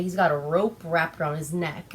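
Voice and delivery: ordinary talk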